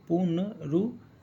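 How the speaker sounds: पुनरुत्थान checked slowly, "pun... ru... thaan"; sounding it out. Shown as a demonstration. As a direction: spell through पुनरुत्थान with long gaps. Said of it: "सही लिखा है क्या".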